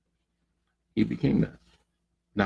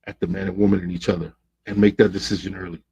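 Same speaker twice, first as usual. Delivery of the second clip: normal talking voice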